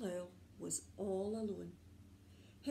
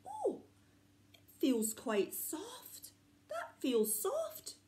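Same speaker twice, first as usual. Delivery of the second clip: wondering owl; 8 semitones higher than usual. Acted. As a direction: feel it gently and marvel. Owl touching feather.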